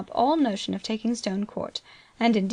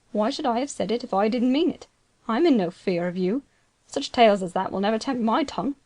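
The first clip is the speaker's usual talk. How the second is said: usual speaking voice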